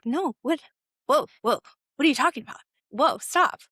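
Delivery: acting out a startled objection; alarmed protest. Retold alarmed protest at teacher quitting.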